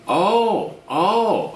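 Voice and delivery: high, loud startled oh; surprised oh. Retold or imitated. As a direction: act caught off guard, then sink. Chad caught out, retold reaction.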